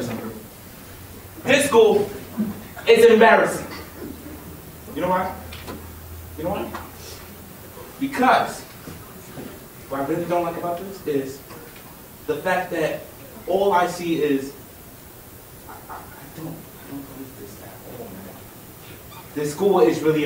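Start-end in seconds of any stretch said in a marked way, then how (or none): 1.4-3.7 angry outburst. Acted. Student rants to the audience, angry shout. 15.7-19.2 breath-only, dropping away to almost nothing; broken whisper. Acted. Student admits how much the school hurts, whispered. Student's rant collapses into despair.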